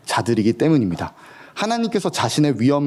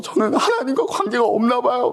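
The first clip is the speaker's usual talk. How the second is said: nachgeahmtes Weinen; 10 semitones higher than usual. Retold or imitated. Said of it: "정말 막 울면서".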